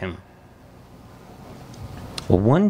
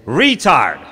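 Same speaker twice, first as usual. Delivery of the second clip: mock jeer; 2 semitones higher, 7 dB louder than usual.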